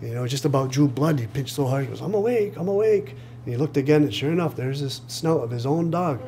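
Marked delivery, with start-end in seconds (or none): 2.0-3.1 acted high-pitched insistence; higher voice, convincing yourself as you say it; insistent disbelief. Storyteller voices a man pinching himself, insistent and high.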